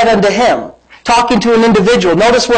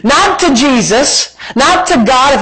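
preaching; 6 semitones higher, much slower than usual. Acted. Preaching, slow.

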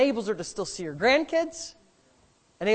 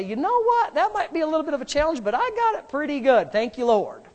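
much higher, bright mock-cheerful voice; grateful believer; 9 semitones higher, 5 dB louder than usual. Preacher voicing a grateful churchgoer, cheerfully higher. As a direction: play a content believer counting his blessings. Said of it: "look around and say".

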